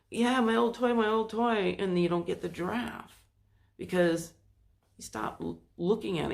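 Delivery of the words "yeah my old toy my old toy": put-on higher, louder kid voice; happy kid. The kid choosing the old toy, happy. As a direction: chirp it like a child. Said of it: "the kid is like".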